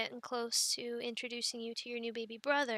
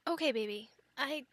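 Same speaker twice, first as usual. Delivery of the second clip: usual speaking voice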